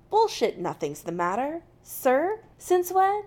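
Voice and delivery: disbelieving, climbing high and loud at the end; incredulous echo. A narrator's reading. Jim challenging McCoy's formal denial, incredulous. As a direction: throw the words back in disbelief.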